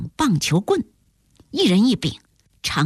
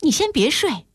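eager, higher-pitched interjections; quick repeated calls, pitched up; impatient; 6 semitones higher than usual. A narrator's reading. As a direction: stop a friend from dropping off, urgently. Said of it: "迫不及待地说".

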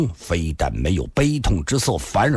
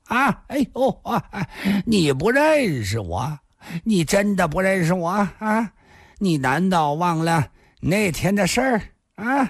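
put-on high drunken protest with bursts of cackling; jeering drunk youth; 9 semitones higher than usual. A narrator's reading. Drunk youth challenging the woman who denies him, jeering. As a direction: taunt her with incredulous, high laughter.

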